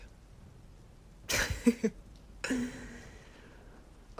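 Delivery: chuckle, then let it trail off. Acted. Boyfriend admits he is bored, chuckling. Amused at being bored together.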